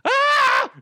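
high startled yelp; a sudden shriek of shock; startled scream. Retold or imitated. Watching the crocodile video with daughter, high shocked shriek. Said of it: "I'm like".

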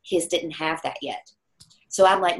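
ordinary talk, nothing marked

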